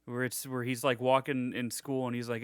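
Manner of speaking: ordinary talk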